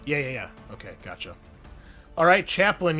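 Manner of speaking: normal talking voice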